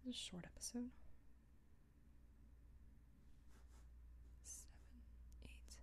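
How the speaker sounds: breathy whispered aside; whispering to self. Real, not acted. Writer noting the episode length to herself, whispered. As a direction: whisper it to yourself. Muttering to self while working.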